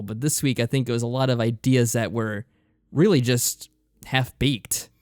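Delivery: ordinary talk, nothing marked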